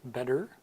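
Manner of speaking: better with a quick D-like T and final R; American flap T. Shown as a demonstration. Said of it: "better in American English".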